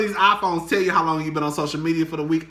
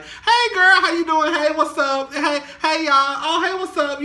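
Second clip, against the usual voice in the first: much higher, louder, sing-song hellos; bubbly greeting; 12 semitones higher, 4 dB louder than usual. Retold or imitated. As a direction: beam at every coworker you pass. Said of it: "the bubbly".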